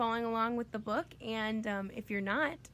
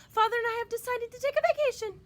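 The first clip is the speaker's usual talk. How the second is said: much higher, louder sugary mother; 12 semitones higher, 7 dB louder than usual. A narrator's reading. Mother telling the children at dinner, high sugary voice. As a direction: announce it like a cheery storybook mother.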